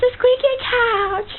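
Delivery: high, slow squeaky zebra; squeaky zebra noise. Pretend. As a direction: squeak like a creaky couch. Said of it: "sounds like you're on a squeaky couch".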